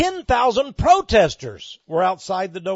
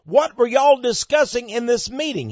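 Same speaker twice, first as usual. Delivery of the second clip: put-on louder, higher Texas accent; 6 semitones higher, 6 dB louder than usual. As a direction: ask it pointedly in a Texas accent. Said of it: "That's my Texas accent".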